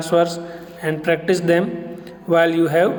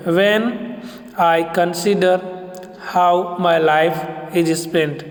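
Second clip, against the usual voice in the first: slow, even da-DUM beat on "When I consider how my life is spent"; teacher slowly beats out the iambic metre for students; iambic reading; slower than usual. Shown as a demonstration. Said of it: "The first two lines of the poem illustrated".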